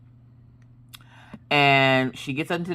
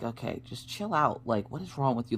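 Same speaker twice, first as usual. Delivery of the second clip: quiet self-scolding; 9 dB quieter than usual. Retold or imitated. Scolding herself after yelling.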